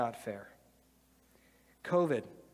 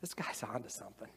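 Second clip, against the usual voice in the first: softer, awed murmur to oneself; quiet realization; 6 semitones lower, 12 dB quieter, faster than usual. Retold or imitated. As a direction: hush it like a discovery. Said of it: "you're like".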